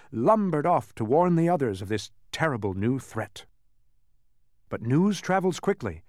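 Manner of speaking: usual speaking voice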